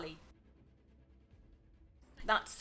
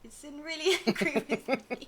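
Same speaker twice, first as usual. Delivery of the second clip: higher, louder mock question through laughter; amused imitation; 7 semitones higher, 8 dB louder than usual. Teasing the co-host with their own past question, laughing imitation. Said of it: "and you were like".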